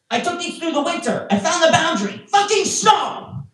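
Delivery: very high, exasperated comic rant; mock outrage. Comic ranting about winter shoes, high-pitched exasperation.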